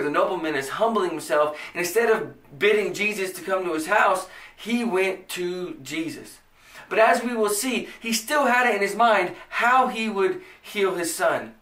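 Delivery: usual speaking voice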